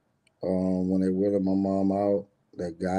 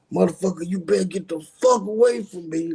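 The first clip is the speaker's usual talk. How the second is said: high-pitched retold yelling, pushed up hard; mock loud scream; 8 semitones higher than usual. Brother yells at the crowd after the funeral, high mock scream. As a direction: scream it like he's inciting a riot. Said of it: "like, loud screaming".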